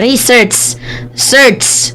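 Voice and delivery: imitated Filipino accent on English; Mike Abe. Vlogger showing how he talks, Filipino accent. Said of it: "Ganon siya magsalita".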